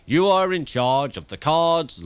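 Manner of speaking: pompous boss assigning jobs; important. A narrator's reading. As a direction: give the order grandly. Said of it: "he said importantly".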